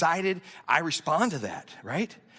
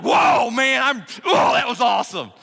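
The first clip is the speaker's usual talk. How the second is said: loud, high, hyped-up whoop; pumped-up shout, pitched high; fired up; 6 semitones higher, 8 dB louder than usual. Acted. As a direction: act out a moviegoer bursting with excitement. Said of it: "you got so fired up".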